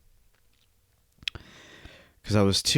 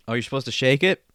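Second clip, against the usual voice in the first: normal talking voice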